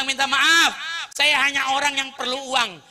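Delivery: high, loud desperate plea; pleading apology. Retold or imitated. Hired insulter confessing to Ali and begging, pleading. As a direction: beg for forgiveness.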